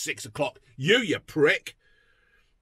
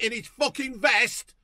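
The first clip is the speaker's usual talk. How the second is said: usual speaking voice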